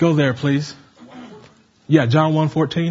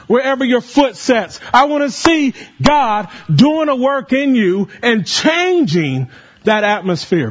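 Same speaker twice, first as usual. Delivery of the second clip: impassioned preaching; 6 semitones higher than usual. Acted. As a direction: pour your heart out to the church. Preaching at full force.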